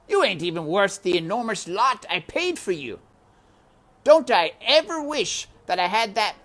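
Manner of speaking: loud scornful man's voice; loud mean uncle voice; Uncle sneering. Acted. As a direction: jeer at her cruelly. Uncle insults Meg.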